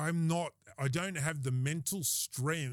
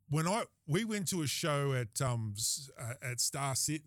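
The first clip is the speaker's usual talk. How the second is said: ordinary talk, nothing marked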